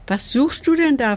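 high, lively curious question. A narrator's reading. Tick-Tack asking the mouse under the sofa, curious. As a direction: ask it with lifted, inquisitive pitch. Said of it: "fragte Tick-Tack neugierig".

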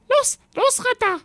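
very high, squawky little dragon urging; urging squawk. A narrator's reading. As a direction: hurry him along in a tiny squawk. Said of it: "drängte Roderich".

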